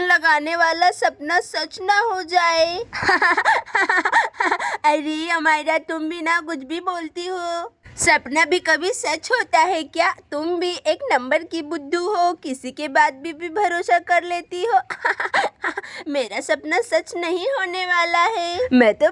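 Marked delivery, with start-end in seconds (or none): 2.8-4.8 high playful giggle at her friend's fear; teasing giggle. Acted. Amayra teasing Siya that the dream will come true, giggling. 14.9-16.0 giggle. Acted. Laughing after calling her silly.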